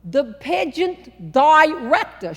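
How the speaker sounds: put-on slow, deliberate New York accent; slow enunciated Northern accent. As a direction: spell it out for the Southerner. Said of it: "She slowed down and enunciated".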